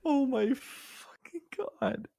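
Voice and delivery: real drawn-out horror; stunned disbelief. Real, not acted. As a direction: draw it out, aghast and hushed. Listener hears of near fight.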